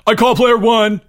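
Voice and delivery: put-on loud, high boyish claim; yelled. A narrator's reading. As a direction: yell it out to beat everyone. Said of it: "Greg yelled".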